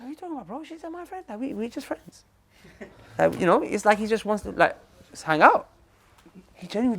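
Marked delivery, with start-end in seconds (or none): 0.0-2.2 higher, softer puzzled voice; lightly voiced baffled friend, pitched up; confused friend. Friend brushing off the warning, higher and softer. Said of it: "He's like".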